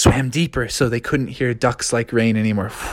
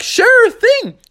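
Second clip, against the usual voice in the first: cheerful Raffi; 4 dB louder than usual. Acted.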